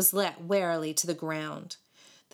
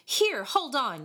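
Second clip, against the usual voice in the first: slow, higher-pitched call to halt; mild yell; 6 semitones higher, 3 dB louder than usual. A narrator's reading. Tallest boy stopping the fiddler, mildly yelling. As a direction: call out to stop them, not full force. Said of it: "yelled the tallest boy".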